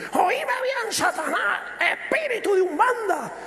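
high, commanding rebuke of Satan; deliverance preacher. Retold or imitated. Preacher on the cassette rebuking Satan, commanding and high. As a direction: bark it like a preacher casting out demons. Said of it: "se le salta la cadena y dice".

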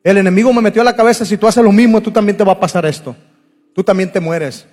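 loud, high-pitched preaching appeal; preaching at full force. Acted. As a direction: cry out to the congregation. (predicando a todo pulmón)

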